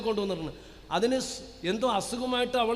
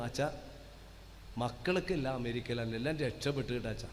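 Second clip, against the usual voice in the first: low, soft and weary; a tired old mother murmuring to the priest; softer, lower voice of a worn-out grandmother; frail old woman; 10 semitones lower, 7 dB quieter than usual. Retold or imitated. Weak old mother speaks.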